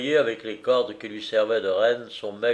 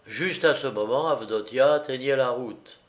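ordinary delivery

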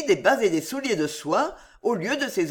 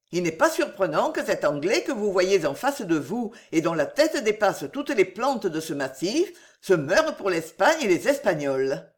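plain reading voice

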